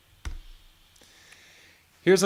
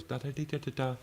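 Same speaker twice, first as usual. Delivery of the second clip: quietly sung little phrase; soft version; 5 semitones higher, 7 dB quieter than usual. Shown as a demonstration. Teacher demos a soft wrist flick, quietly sung phrase. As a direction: hum the phrase softly, like a gentle flick. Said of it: "it was soft".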